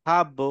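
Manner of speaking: "হাব" with ব rebounding as bo; qalqalah echo. Shown as a demonstration. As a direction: bounce off the end of "হাব". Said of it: "এরকম হবে".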